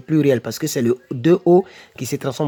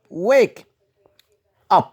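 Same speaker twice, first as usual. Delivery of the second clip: ordinary delivery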